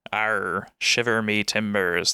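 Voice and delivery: drawn-out pirate talk; pirate voice with rolled-out R sounds; classic pirate. Retold or imitated. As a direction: imitate a classic storybook pirate. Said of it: "talks in that is the classic pirate".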